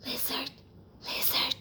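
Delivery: soft, breathy whisper calling the lizard; a small mouse whispering into the bush. Acted. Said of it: "he whispered".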